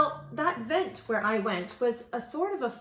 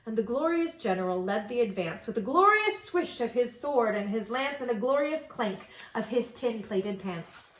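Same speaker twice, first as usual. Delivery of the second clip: ordinary delivery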